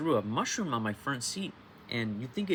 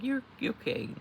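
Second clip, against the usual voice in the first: mock viewer; 13 semitones higher than usual. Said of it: "I know you're gonna be like".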